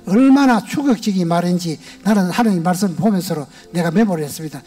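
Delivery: ordinary talk, nothing marked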